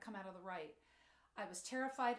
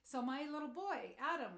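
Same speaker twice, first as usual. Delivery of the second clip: normal talking voice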